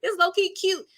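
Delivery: high gushing coo. Retold or imitated. Watching both men get jealous, high gushing coo. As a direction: coo over a cute moment. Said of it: "I'm like".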